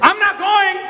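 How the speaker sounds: high-pitched exasperated outburst; lost it. Retold or imitated. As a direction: blow up in frustration, voice climbing high. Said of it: "I lost it".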